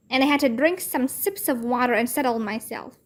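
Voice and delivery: ordinary delivery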